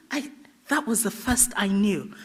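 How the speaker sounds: usual speaking voice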